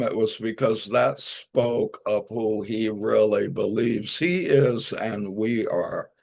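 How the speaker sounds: ordinary talk, nothing marked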